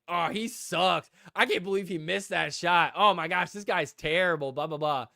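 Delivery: exasperated heckler, lightly acted; mock heckling. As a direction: imitate a fan complaining out loud. Mimics a woman heckling a player.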